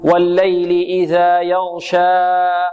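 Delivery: slow recitation, last aa of يغشى held far too long; overlong final vowel. Shown as a demonstration. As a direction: demonstrate the mistake of overstretching يغشى. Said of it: "نمدها أكثر من حركتين".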